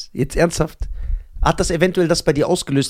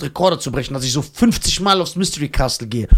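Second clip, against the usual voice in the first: normal talking voice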